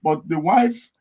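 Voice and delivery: ordinary talk, nothing marked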